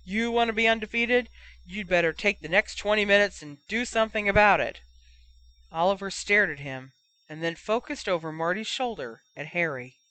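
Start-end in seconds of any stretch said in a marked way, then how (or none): 0.0-4.8 raised, forceful telling-off; roaring. A narrator's reading. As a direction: roar at your captain to rouse him. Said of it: "he roared".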